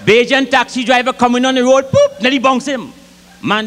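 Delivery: ordinary delivery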